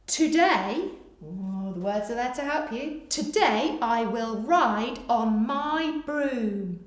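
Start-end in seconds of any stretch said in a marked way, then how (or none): 3.1-6.8 very slow, careful dictation. Shown as a demonstration. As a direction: stretch every word for writers. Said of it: "Pause here whilst you finish".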